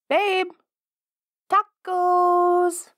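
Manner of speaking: high, loud drawn-out call. Acted. Hunting for partner through the house, loud lure of tacos. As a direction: shout it down the hall to lure them.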